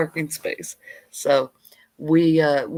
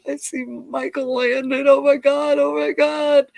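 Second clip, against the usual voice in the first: put-on high, giddy little-girl gasp; breathless fan girl, much higher and louder; starstruck squeal; 11 semitones higher, 6 dB louder than usual. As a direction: gush like a starstruck kid. Ten-year-old spotting her hero.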